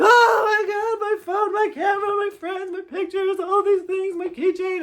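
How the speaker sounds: high, loud mock wail of despair; frantic, high-pitched imitation of crying; imitated crying wail. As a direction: wail it out like a distraught kid. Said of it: "she's still crying".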